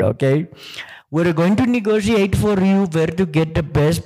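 imitating an Indian-accented Siri; mock Indian accent. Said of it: "Siri designed by Indians".

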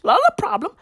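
a high mocking echo; mock high repeat. Mocking repeat, pitch rising.

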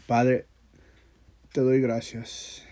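normal talking voice

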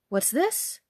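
amazed mother's exclamation; astonished cry. Acted. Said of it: "cried her mother".

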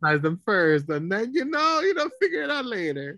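high, singsong mock reassurance; mock-sweet parent plan. Joking about scaring kids with horror first, mock-sweet.

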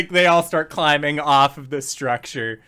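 ordinary talk, nothing marked

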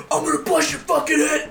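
high-pitched mock yell; hammed-up aggressive shout, voice pushed high; mock threat. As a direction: imitate a hyped-up tough guy threatening. Acting out threatening bounty hunters.

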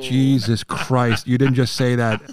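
very slow, laughing disbelief; mortified aside. Retold or imitated. Uncle mortified by nephew, slow laughing groan. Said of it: "And I was like".

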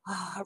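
long, slow sigh of relief; relieved sigh. Shown as a demonstration. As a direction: let out a slow sigh, sinking back. Demonstrates relaxing back in a recliner.

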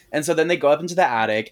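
ordinary talk, nothing marked

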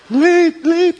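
high, loud whiny "late, late"; mock whiny complaint. Preacher mocking the grieving sisters, shrill whine.

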